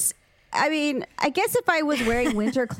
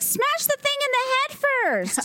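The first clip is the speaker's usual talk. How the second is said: very high, urgent exclamation; exasperated plea; 14 semitones higher than usual. Retold or imitated. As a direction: urge her on, pitched way up. Yelling at TV contestant.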